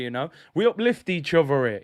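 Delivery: ordinary delivery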